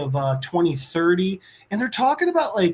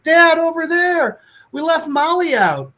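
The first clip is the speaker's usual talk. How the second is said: put-on high, loud panicked kid's cry; alarmed child; 11 semitones higher, 6 dB louder than usual. Son shouts to his dad about the dog left outside: high, loud panic. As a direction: sound the alarm like a scared boy.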